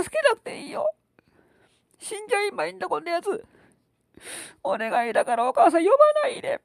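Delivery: put-on high pleading tearful child; a little girl begging mom through tears; crying little girl. Child begs, 泣いてすがった.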